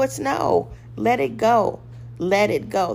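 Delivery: ordinary talk, nothing marked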